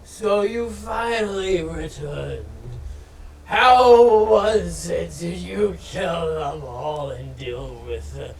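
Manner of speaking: booming, slow rat lord greeting; rat king voice. Acted. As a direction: boom it out like a proud rat king.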